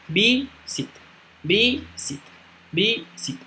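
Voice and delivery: "visit" broken into two syllables, vi... sit; split into syllables. Shown as a demonstration. Teacher counting two syllables for students, "visit" split vi-sit. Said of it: "dos sílabas".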